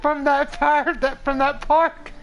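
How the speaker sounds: high, squeaky-strained telling through laughter; helpless laughter. Real, not acted. Storyteller recalling the park fire, cracking up helplessly. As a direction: barely get the words out for laughing.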